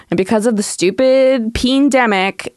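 ordinary talk, nothing marked

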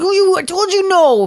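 high, scolding herding dog; a bossy dog telling off. Retold or imitated. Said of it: "Max is like".

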